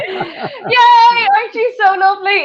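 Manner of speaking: very high, loud mock-delighted squeal; gushing cheer. Retelling her reply to a doting man, high gushing cheer. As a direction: cheer it high, then coo.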